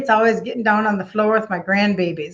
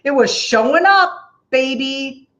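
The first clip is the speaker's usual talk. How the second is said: sassy flourish; 4 semitones higher, 5 dB louder, slower than usual. Real, not acted. Playful dramatic flourish.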